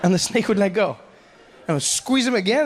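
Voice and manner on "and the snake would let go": ordinary delivery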